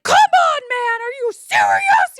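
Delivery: high frustrated shout; exasperated road-rage shout; yelled. Retold or imitated. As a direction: yell it at the truck behind you. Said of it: "so I yelled at him".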